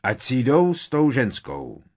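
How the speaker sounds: high, loud dismissive holler. A narrator's reading. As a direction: holler it from the crowd. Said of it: "houkl šedohnědý kentaur".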